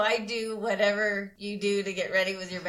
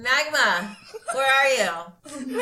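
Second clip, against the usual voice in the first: loud, raised call with a laugh in it; yelling; 5 semitones higher, 5 dB louder, much slower than usual. Acted. Performer hunting for a friend in the audience, yelling. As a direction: call out across the crowd, drawn out. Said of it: "and I'm yelling".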